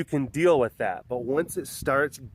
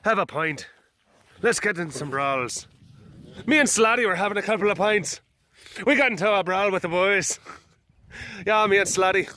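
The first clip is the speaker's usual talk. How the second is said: hammed-up Irish accent, higher than usual; Irish tough accent; 6 semitones higher, 3 dB louder than usual. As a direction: play a brawling Irish tough. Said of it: "some Irish tough".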